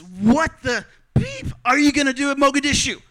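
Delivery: loud, high angry outburst at strangers; retold yell. Passenger jumps up and yells at the couple, loud high shout. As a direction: shout it like she's jumped up to confront you. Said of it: "she yells at us".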